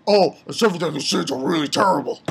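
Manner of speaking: a hint of a pompous knight voice; lightly mock the knight's preaching; mock Holy Knight. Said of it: "He's saying".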